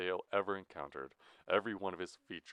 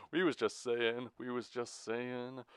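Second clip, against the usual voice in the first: higher, falsely charming stalling; plastic charisma; 5 semitones higher than usual. A narrator's reading. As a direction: stall with put-on salesman charm. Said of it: "spoke with plastic charisma".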